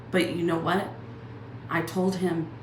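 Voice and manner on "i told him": normal talking voice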